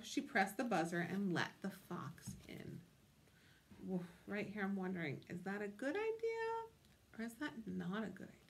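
usual speaking voice

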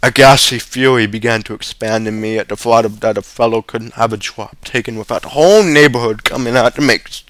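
usual speaking voice

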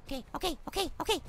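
low, barely voiced reassurance; steady yourself under the breath; hushed nerves. Real, not acted. Player hearing the rake scream, hushed nervous self-soothing.

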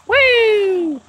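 high cheer; happy whoop. Real, not acted. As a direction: let out a joyful woo. Cheers driving into water.

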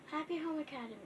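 ordinary delivery

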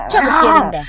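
high, loud hurry-up call; loud dog-voice call. Pretend. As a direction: yell at them to hurry. Loud repeat.

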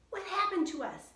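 put-on a young girl's high, loud startled question; high, loud little-girl alarm; Annie voice.

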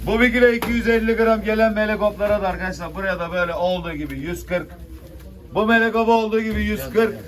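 loud auction call; auctioneer. Real, not acted. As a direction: call the lot to the room. Fish auction bidding call.

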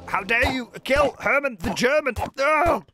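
high, hammed-up outraged hero; mock vengeful fury. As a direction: swear revenge on the killer. Vows revenge on the evoker.

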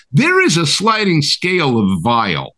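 normal talking voice

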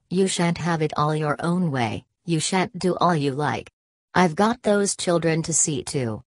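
ordinary delivery, nothing marked

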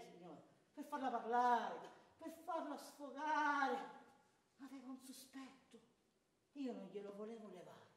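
ordinary talk

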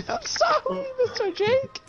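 put-on high childlike servant voice; imitating the slave voice. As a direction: mimic the squeaky apology. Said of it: "you just turned, like, five for a second".

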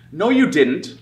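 high, loud retort; snapping. A narrator's reading. Little boy Sam contradicting the monster, snapping. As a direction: snap back indignantly. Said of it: "snapped Sam".

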